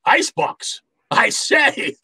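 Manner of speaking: high, surprised exclamation; delighted surprise. Acted. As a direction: squeal it with glee. Gutman delighted by the icebox.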